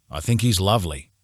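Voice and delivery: plain reading voice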